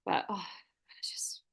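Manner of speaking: weary, breathy half-whisper; exhausted whisper. Real, not acted. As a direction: sigh it out, drained. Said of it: "feel exhausted".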